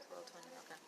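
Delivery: whispered aside. Real, not acted. Checks time for class, aside.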